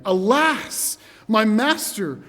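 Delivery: loud, high, alarmed plea; anxious cry. Acted. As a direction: cry out to your master in dread. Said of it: "you can feel it in his voice".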